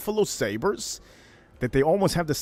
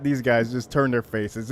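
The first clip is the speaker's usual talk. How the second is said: ordinary delivery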